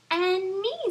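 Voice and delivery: high, loud little flea; a tiny flea chiming in. Acted. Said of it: "said the flea".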